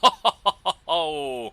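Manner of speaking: loud, long gleeful laugh; delighted laugh. Real, not acted. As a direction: laugh out loud at the lucky hit. Torpedo hit sets off detonation.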